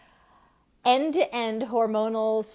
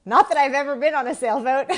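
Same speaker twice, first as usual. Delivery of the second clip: higher, louder, laughing confession; 8 semitones higher, 8 dB louder than usual. Real, not acted. Host admitting to viewers she never sailed, laughing. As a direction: crack up at your own joke. Joking about her sailor outfit.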